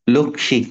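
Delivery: লক্ষ্মী said lokkhi, the m silent; লক্ষ্মী with a silent m, read out as lokkhi; silent m demo. Shown as a demonstration. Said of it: "ক্ষিয়র সাথে ম ফলা আসলে silent থাকে".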